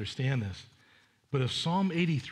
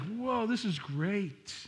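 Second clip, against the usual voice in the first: mock blissful sigh; 6 semitones higher, much slower than usual. Blissful sleeper voiced in story.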